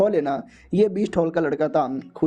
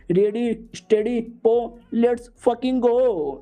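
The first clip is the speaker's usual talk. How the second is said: higher, slow, every word stretched; drawn-out hype call; 5 semitones higher, much slower than usual. Acted. Senior elder starting the contest with a stretched hype call. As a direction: build it up like a race starter.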